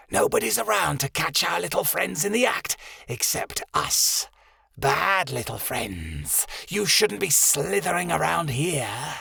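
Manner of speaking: slow, hissing cobra taunt; sinister snake voice. Acted. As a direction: taunt them like a cold snake.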